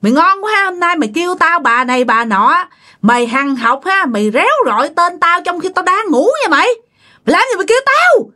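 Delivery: high-pitched irate mother-in-law; indignant high scolding; angry scolding. A narrator's reading. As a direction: tear into the daughter-in-law, pitch jumping up. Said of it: "bà mới chửi nha".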